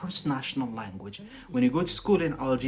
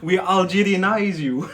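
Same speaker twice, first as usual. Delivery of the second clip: louder, laughing; 5 semitones higher, 5 dB louder than usual. Real, not acted. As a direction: say it through laughter. Laughing tease.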